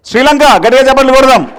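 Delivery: loud, high shout to the hall; rousing emcee cry; applause call. Real, not acted. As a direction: get everyone clapping hard. Announcing country, calling for applause.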